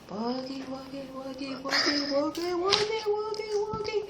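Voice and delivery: spooky trees. Acted. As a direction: chant softly as haunted trees, creeping upward. Trees speak eerily in forest.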